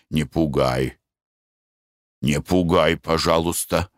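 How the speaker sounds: low, uneasy pleading; nervous husband. Acted. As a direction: ask her to stop, uneasy. Husband frightened now.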